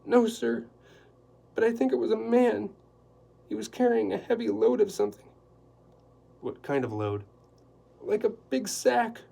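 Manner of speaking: a high, loud, flustered housekeeper; anxious housekeeper. Acted. Housekeeper explaining the dinner to Quillerin, flustered and high. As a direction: rattle it off in a nervous flurry.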